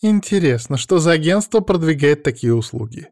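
high-pitched mocking inquiry. A narrator's reading. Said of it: "Послышалось насмешливое позади".

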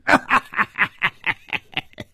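long villainous guffaw; staccato belly laugh; big laugh. A narrator's reading. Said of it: "老严大笑着说".

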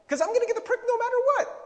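high, fast, loud exasperated squeal; a high, fast, loud squeal of comic exasperation; exasperated outburst. Real, not acted. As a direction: throw up your hands at your own silly logic. Exasperated at own logic.

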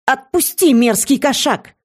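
high, angry demand to be let go; indignant outburst. A narrator's reading. Friend dragged in by a shapeshifter, angry high outburst. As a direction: fire it out angrily, pitched well up. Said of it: "выдала подруга".

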